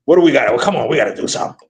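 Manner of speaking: a light Thibodeau impression; mock Tom Thibodeau. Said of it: "That's Tom Thibodeau conversation".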